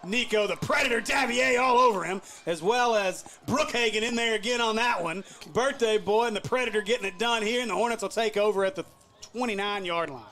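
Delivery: high, loud hyped call; excited play call. Real, not acted. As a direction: call the big tackle at full hype. Big special teams tackle called.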